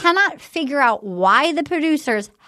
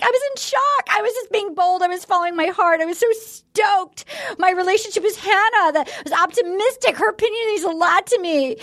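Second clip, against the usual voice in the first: high-pitched imitation of a gushing contestant; Heather; 5 semitones higher than usual. Contestant pleads her case to the group, imitated in a high gushing voice. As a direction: retell her gushing defense, pitched well up. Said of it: "Heather was like".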